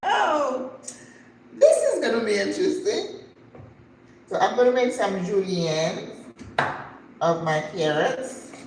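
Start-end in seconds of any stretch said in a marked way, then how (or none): 0.1-3.3 laughing exclamation. Real, not acted. Driver speaks only Kinyarwanda.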